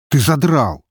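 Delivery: loud, high outburst; fed up, cutting in sharply; exasperated. A narrator's reading. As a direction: snap at a rambling friend. Fed-up friend cuts storyteller off.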